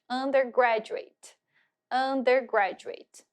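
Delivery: "undergraduate" said slowly, syllable by syllable; teacher model of "undergraduate"; model pronunciation. Shown as a demonstration. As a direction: spell out "undergraduate" in sound, slowly.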